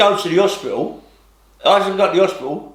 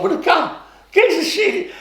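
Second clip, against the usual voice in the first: laughing; 13 semitones higher than usual. Real, not acted. Laughing at old man's gunpoint.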